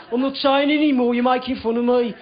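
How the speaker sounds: protesting Birmingham flatmate; Birmingham accent. Retold or imitated. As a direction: object in an imitated Birmingham accent. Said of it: "He's like".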